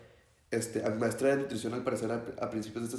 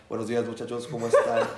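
teacher greeting, broken by laughter; laughing teacher quote; 5 dB louder than usual. Retold or imitated. Retelling how the teacher greeted the class after waking, laughing. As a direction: laugh through the innocent greeting.

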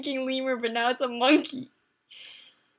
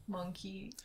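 whispering; 6 semitones lower, 15 dB quieter than usual. Real, not acted. Answer whispered to teammate.

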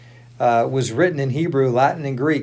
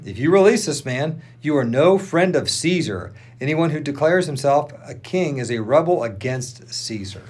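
ordinary delivery, nothing marked

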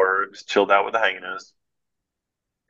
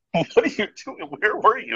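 much higher, alarmed questioning; frantic zoologists; 11 semitones higher than usual. Retold or imitated. Zoologists confront the missing man, high alarmed questions. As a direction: mimic the worried search team, pitched up.

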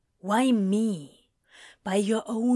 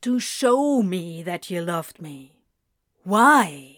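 insistent, ending on a louder question; urgent demand; 3 semitones lower, 4 dB louder than usual. A narrator's reading. Demon demands why angel showed love, insistent. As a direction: press hard on the last word. Crowley pressing for an answer.

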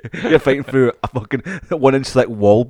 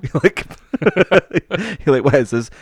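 laughing through a mock baffled question; 6 semitones higher, 3 dB louder than usual. Mate retelling a cheap cheese find, laughing. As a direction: giggle out a mock what is this.

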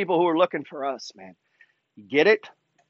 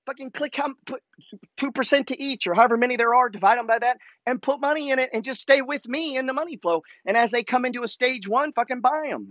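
higher-pitched excited rant; 7 semitones higher than usual. Real, not acted. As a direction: fire it off, all worked up. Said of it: "as I ranted there at the end".

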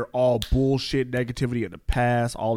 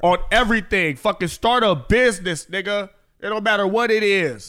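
escalating comedy rant; 7 semitones higher, 3 dB louder than usual. Real, not acted. Hyped New Year advice rant.

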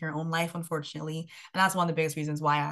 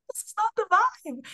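sassy fed-up; 4 dB louder than usual. Retold or imitated. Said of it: "And I was like".

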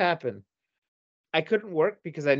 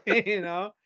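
normal talking voice

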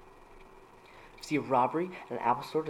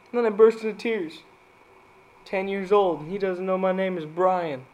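mock crying; 6 semitones higher, 5 dB louder than usual. Dad joke punchline, put-on sob.

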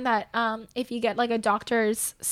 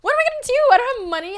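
high, loud, rushed worry; retold panic; 10 semitones higher, 7 dB louder, faster than usual. Traveller fearing being stuck in Canada, retold panic. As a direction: blurt it out as if panicking.

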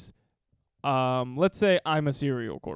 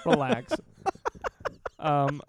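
ordinary talk

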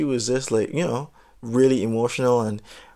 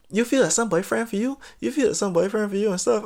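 put-on higher, sneering girl voice; mocking the girl; 8 semitones higher than usual. Imitating the girl taunting the storyteller, higher and sneering. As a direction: taunt him like she did.

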